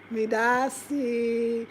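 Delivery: very slow, separated "Me da si". Shown as a demonstration. Vlogger demonstrating the Twi thanks slowly. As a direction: space out "Me da si" smiling. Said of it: "Make sure you smile".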